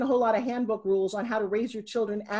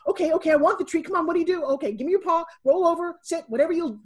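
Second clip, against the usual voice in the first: higher, louder, excited dog begging for a treat; eager dog; 7 semitones higher, 4 dB louder than usual. Retold or imitated. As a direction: play an over-eager dog rattling off offers. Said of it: "the dog's like".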